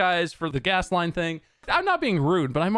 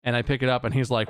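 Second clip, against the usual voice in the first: ordinary talk, nothing marked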